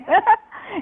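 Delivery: a high burst of laughter at her own slip; sheepish high giggle; embarrassed laugh. Real, not acted. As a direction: laugh it off, caught out. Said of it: "Well, I guess I just told her last name".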